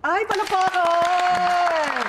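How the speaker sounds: high, slow, loud cheer; excited cheer. Real, not acted. Host cheers guest's British accent, loud high call. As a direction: stretch out the applause call.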